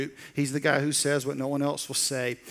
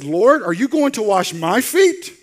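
incredulous Peter; 4 semitones higher, 9 dB louder, slower than usual. Acted. Peter's incredulous question voiced.

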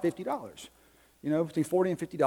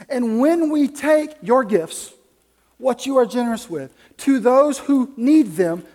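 louder, higher, driving sermon delivery; full-force preaching; 5 semitones higher, 9 dB louder than usual. Acted. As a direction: preach it out to the congregation.

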